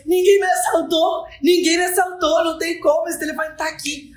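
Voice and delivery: high, insistent protest; exasperated boyfriend. Retold or imitated. Mateus insisting to his panicked girlfriend, high and exasperated. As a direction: insist nobody robbed you, voice up high.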